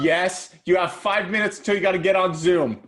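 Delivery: loud call to another room. Real, not acted. Calling to daughter about Zoom.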